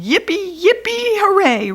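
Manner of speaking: gleeful squeal of joy; cheering. A narrator's reading. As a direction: cheer it like a thrilled kid. Cheering at breakfast treat.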